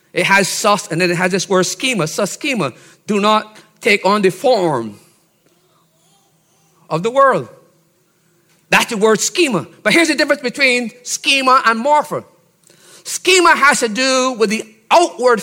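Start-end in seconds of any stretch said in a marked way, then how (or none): none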